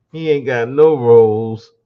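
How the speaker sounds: ordinary delivery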